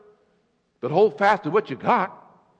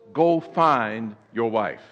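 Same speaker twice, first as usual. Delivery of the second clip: voice of God; 8 semitones lower, slower than usual. Acted. God voiced commanding Hosea.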